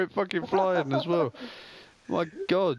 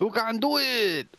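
mock cheer; 7 semitones higher, 3 dB louder, slower than usual. Echoing teammate's encouragement.

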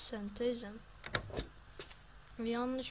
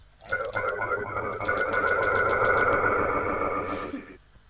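loud laughter; 12 semitones lower, 13 dB louder than usual. Real, not acted. Burst of laughter at a mistake.

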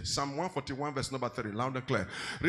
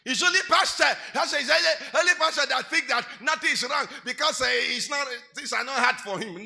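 mocking complainer; 8 semitones higher, 10 dB louder than usual. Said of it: "check what you say".

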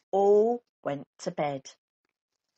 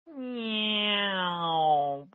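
child's pretend noise; 3 semitones higher, 3 dB louder than usual. Baby Bear plays aeroplane noise.

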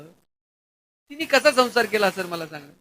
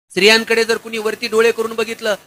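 ordinary talk, nothing marked

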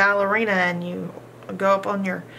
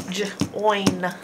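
breathy unvoiced Join; whispered word. Shown as a demonstration. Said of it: "Our next word is join".

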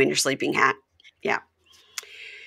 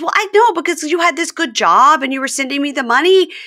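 high, louder whiny excuse; hammed-up defensive Jasmine; mock Jasmine; 12 semitones higher, 6 dB louder than usual. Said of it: "And she goes".

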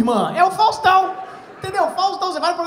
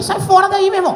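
loud, high-pitched telling-off; acted outraged yell; indignant shout; 10 semitones higher, 9 dB louder than usual. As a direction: shout at her to back off, reenacted. Retelling his protest to the healer.

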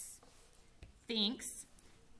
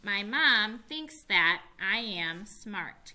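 slow reading; 5 dB louder, slower than usual. Shown as a demonstration. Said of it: "Can you read it with me".

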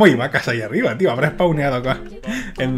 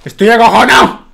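much louder, higher frightened yell; scared shout; 5 semitones higher, 9 dB louder than usual. Real, not acted. Streamer admitting his fear to viewers, loud yell. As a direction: blurt out your fear at full volume.

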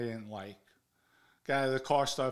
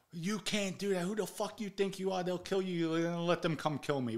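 mock berating, pitched up; retold angry scolding; 6 semitones higher than usual. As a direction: imitate a heated telling-off. Retold furious argument with father.

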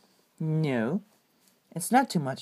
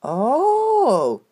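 upset cry; 5 semitones higher, 5 dB louder than usual. Acted. Reaction to bad news.

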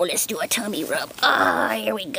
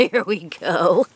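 usual speaking voice

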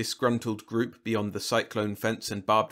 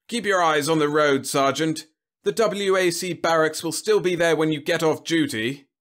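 high, loud, blustering General; pompous military man holding forth; self-important General; 8 semitones higher, 7 dB louder than usual. Acted. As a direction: boom it out, pitched well up. General orders his driver.

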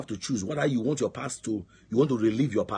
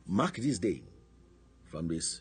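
ordinary delivery

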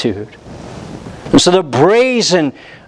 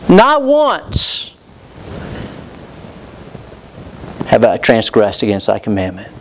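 brazen boast; 6 semitones lower than usual. Acted. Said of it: "He shows them the brazen attitude".

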